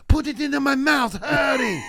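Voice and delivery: high, loud frantic shout; mock urgent boy. Boy offers to save bleeding teacher, mock frantic yell. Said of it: "I, as a boy, said".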